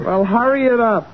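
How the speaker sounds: put-on southern accent. Woman hurrying the old molder, put-on southern accent. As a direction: turn on a southern accent to coax him. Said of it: "when you put on that southern accent".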